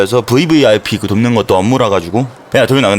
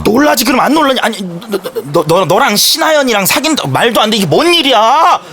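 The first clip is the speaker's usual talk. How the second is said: can't believe what he just heard; stunned disbelief; 11 semitones higher than usual. Acted. Friend stunned by the news.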